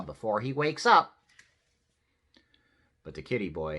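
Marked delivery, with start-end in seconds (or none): 0.0-1.2 raised, louder warning; motherly order. A narrator's reading. Mama orders him before the dog wakes, firm order. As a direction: order it with urgency in the voice. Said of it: "ordered Mama".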